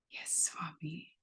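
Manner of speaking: breathless whispered yes, slow and hushed; awed whisper. Retold or imitated. Girl answering Swami up close, awed whisper. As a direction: barely breathe the answer out in awe.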